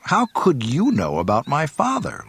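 a little angry. A narrator's reading. Luke challenges the creature about his father, a little angrily. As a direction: push it out with mild anger. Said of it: "asked a little angrily".

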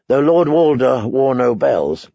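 plain reading voice

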